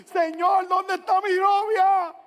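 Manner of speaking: loud, high, drawn-out cry to God; desperate prayer shout. Acted. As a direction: wail it out, pleading for a girlfriend. Acted desperate prayer for girlfriend. (clamor desesperado a gritos)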